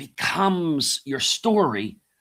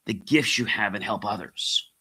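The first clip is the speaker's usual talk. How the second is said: ordinary talk, nothing marked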